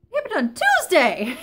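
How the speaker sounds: loud, high scolding mom voice. Acted. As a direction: scold them like an upset mom. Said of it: "my mom voice is out because I'm upset".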